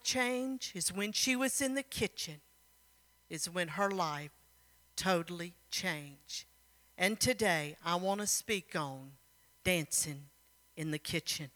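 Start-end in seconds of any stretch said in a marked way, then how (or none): none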